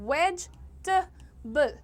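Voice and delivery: vegetable very slow, vej... tuh... bul; three syllables. Shown as a demonstration. Teacher counting just three syllables, vegetable very slowly split. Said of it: "Just three syllables".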